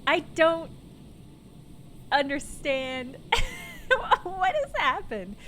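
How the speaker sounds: high, slow, laughing disbelief. Real, not acted. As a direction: laugh through the words.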